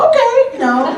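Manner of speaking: high breezy okay; high mock agreement. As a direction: toss it off sing-song. Her reply to dress request.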